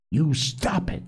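a touch of shrill protest. A narrator's reading. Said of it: "said Piggy shrilly".

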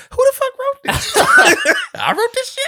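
high, loud mock amazement breaking into a laugh; amazed disbelief. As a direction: gasp at your own brilliance, half laughing. Mock amazement at own writing.

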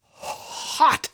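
breathless high yelp of burning mouth; exclaiming. A narrator's reading. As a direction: gasp it out as the pepper bites. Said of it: "Lester exclaimed".

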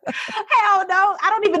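laughing through "hell no", high. Real, not acted. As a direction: crack up while saying it. Laughing at own reaction.

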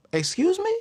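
high incredulous squeak; a sharp, disbelieving excuse me; can't believe what he just heard; shocked. Retold or imitated. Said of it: "I was like".

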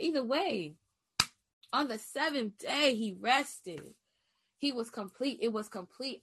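a touch of pulpit cadence, rising line ends; mild sermon lilt; understated preaching voice, not loud; light preaching. Real, not acted. Preaching cadence returns, milder.

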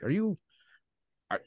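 a touch of a suspicious local; mildly voiced puzzled local; retold local's question. Local questioning her accent, retold.

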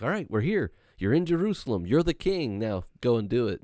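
high, eager push to act; eager disciples. Retold or imitated. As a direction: urge the king on, keyed up. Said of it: "everybody's like".

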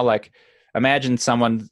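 ordinary talk

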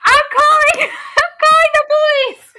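very high, loud wailing screech; over-the-top high-pitched yelling; shrieking vine imitation. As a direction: mimic a viral clip with a hammed-up squeal. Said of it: "Like that vine".

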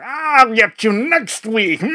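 high, loud, slow cartoon villain threat; mustache twirling villain. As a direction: shout it like a hammy comic-book baddie. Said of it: "that kind of villain that is more like".

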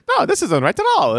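mock fussy voice.

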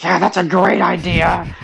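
mild mock excited little boy; overly excited kid voice. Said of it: "overly excited voice".

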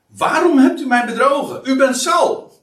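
high, loud accusing cry; a woman shouting at the disguised king; woman's loud cry. Acted. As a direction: cry out, shocked and betrayed. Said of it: "ze schreeuwde met luide stem".

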